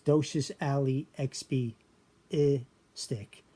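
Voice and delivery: "I stick" as ih-stick, no L before it; chunked "docious, ali, expi", then "I stick" as ih, stick; L dropped. Shown as a demonstration. Teacher showing the dropped L, in separated chunks. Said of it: "You don't hear the L".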